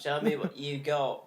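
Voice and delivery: Australian attempt that comes out British; put-on British twang; British accent. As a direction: try Australian, land on British. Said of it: "I just do British".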